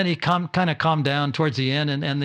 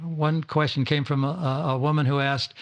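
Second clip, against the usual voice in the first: normal talking voice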